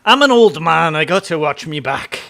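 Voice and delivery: a clumsy try at a Scottish accent, playing an old man; bad Scottish accent. Streamer jokes about his age to chat in a bad Scottish accent. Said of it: "That was my attempt at a Scottish accent".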